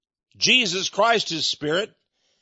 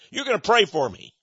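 ordinary delivery

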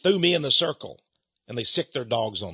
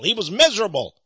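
MISERABLE pushed high and loud; emphatic repeat; 6 semitones higher, 6 dB louder than usual. Real, not acted. Preacher insisting the hater had no joy, stressing MISERABLE. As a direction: lean hard on "miserable", pitch leaping up.

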